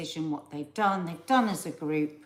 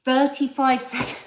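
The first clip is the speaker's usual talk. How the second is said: ordinary delivery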